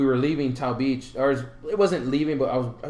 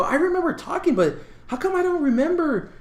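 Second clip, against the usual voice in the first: puzzled; 11 semitones higher than usual. Retold or imitated. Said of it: "I go".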